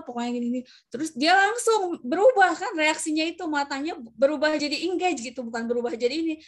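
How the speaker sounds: usual speaking voice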